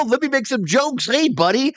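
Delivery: high, put-on jokey host voice; mock a goofy presenter trying to be funny; Pettingill. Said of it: "but Pettingill's like".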